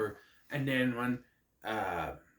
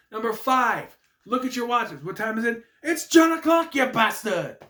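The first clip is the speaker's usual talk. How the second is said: raised pitch building to a loud shout; hammed-up announcement; 7 semitones higher, 5 dB louder than usual. Retrying the number five joke, mock announcer yell. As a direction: build to a yelled punchline. Retakes a hammed-up entrance gag.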